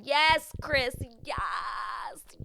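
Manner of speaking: loud, high cheer of approval. Retold or imitated. Recapper applauding Chris for choosing himself, cheering. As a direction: cheer him on loudly.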